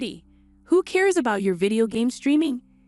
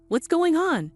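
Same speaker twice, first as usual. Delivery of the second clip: higher, startled question; suddenly worried, demand to know; startled sibling asking what's happening; alarmed surprise; 6 semitones higher, slower than usual. Acted. Alarmed question about expenses.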